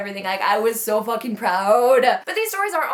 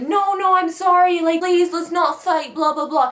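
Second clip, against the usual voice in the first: higher and louder, begging them not to fight; a mother's plea to calm everyone down; pleading mother; 6 semitones higher, 4 dB louder than usual. Retold or imitated. Mother begging her drunk daughter's rivals not to fight, higher and louder. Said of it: "Her mother is there trying to be like".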